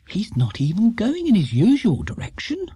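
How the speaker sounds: a lightly voiced worried mother; worried Mrs Brown. A narrator's reading. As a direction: fret that he's up to something.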